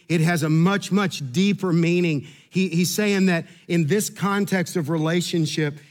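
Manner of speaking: normal talking voice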